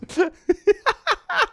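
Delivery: squeaky character giggle; goofy high giggle. Pretend. Character laughs after line.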